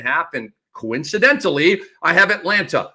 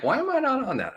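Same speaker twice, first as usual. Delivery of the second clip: high-pitched, softer puzzled quote; self-questioning; 9 semitones higher, 6 dB quieter, faster than usual. Retold or imitated. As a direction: act out asking yourself in surprise. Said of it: "I was like".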